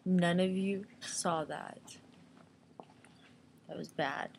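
slow embarrassed whisper. Real, not acted. To viewers after an awkward moment, slow whisper. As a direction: hush it like a secret to the viewers.

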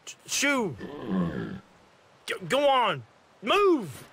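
high exasperated shoo. Acted. Shooing off a pest animal, high and exasperated. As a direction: chase it off, rising irritation.

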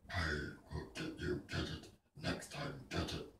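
low, soft, unvoiced villain rasp; Doctor Claw. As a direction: hiss it like a shadowy cartoon villain. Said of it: "Doctor Claw".

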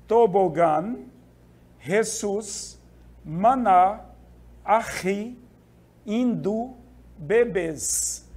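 teacher's spaced list from tobogã to bebês, final syllables brought out; stress on last syllable. Shown as a demonstration. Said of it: "primeiro ela tem que ser tônica".

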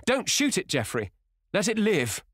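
urgent high, loud plea; crying out. A narrator's reading. Dorian begging Geoffrey to spare the hare, crying out. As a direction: cry out to stop the shot. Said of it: "he cried out at once".

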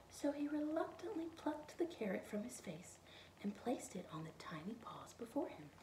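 usual speaking voice

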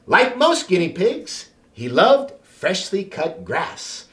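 plain reading voice

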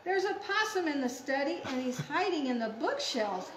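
quick, urgent picture-book alarm; excited story reading. Acted. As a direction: announce the intruder in a hurry.

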